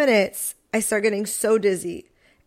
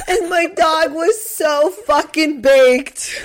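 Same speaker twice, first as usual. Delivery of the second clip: laughing; 6 semitones higher, 5 dB louder, much slower than usual. Real, not acted. Friends recalling the dog story.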